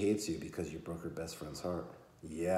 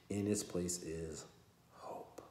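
plain reading voice

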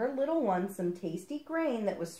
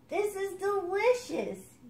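higher, slow, savoring duckling; higher duckling telling the kingfisher it's delicious; delighted duckling; 12 semitones higher, slower than usual. Acted. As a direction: relish the first good bite. Duckling tastes food.

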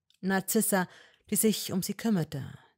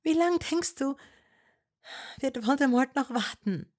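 put-on higher young woman's voice, pleading and firm; Hermine voice; 9 semitones higher, 4 dB louder than usual. A narrator's reading. Hermine to Malfoy, higher and insistent. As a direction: argue with him, voice raised high.

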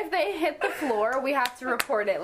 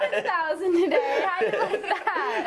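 smug; 5 semitones higher than usual. Retold or imitated. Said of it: "And you're like".

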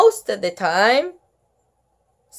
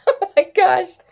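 ordinary delivery

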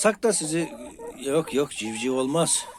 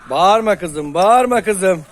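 loud hushing; 7 semitones higher, 9 dB louder, slower than usual. Real, not acted. Hushing a squawking hen.

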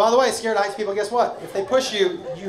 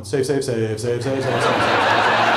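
lower, mumbled frantic chanting; panicked repeating; 10 semitones lower, slower than usual. Retold or imitated. Comedian terrified, repeating the word while falling. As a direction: chant it to stay alive. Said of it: "a video of me going".

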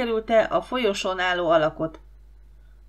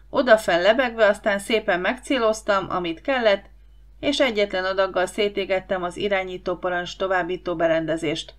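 plain reading voice

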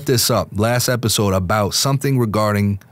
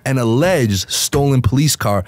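ordinary talk

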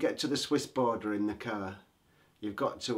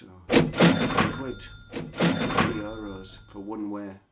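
exasperated loud rant; 8 dB louder, slower than usual. Real, not acted. Ranting about ski lift price.

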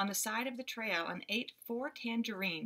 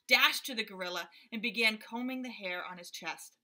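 usual speaking voice

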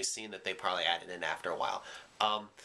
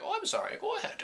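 much higher cartoon voice for the asteroid; talking asteroid. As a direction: make the asteroid sound tiny and polite. Asteroid voiced in his story.

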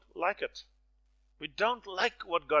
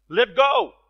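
booming, much louder and higher command; thunderous; 7 semitones higher, 13 dB louder than usual. Retold or imitated. God answers the man on the cliff, thunderous and booming. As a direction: thunder it down from heaven. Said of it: "this time it was thunderous".

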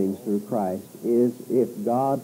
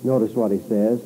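ordinary talk, nothing marked

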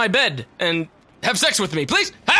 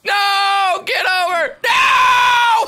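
high, loud cry of no; panicked shout; 11 semitones higher, 6 dB louder, much slower than usual. Real, not acted. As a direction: yell in dismay as you fall. Falling into the water.

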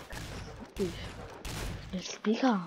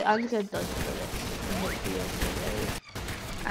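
ordinary delivery

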